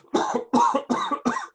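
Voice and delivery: harsh cough from a bad cold. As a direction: hack out a sick cough. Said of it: "He coughs occasionally because he is recovering from a bad cold".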